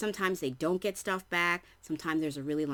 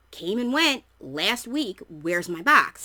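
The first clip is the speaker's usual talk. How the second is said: loud, emphatic retold demand; indignant complaint; 3 semitones higher, 7 dB louder, slower than usual. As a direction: confront the post office, punching the words loudly. Retold complaint to post office.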